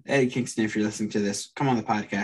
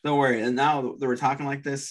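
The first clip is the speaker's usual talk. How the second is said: ordinary delivery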